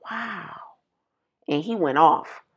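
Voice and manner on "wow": breathless embarrassed whisper; awkward, mortified whisper. Retold or imitated. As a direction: whisper it under your breath. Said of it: "I was so embarrassed".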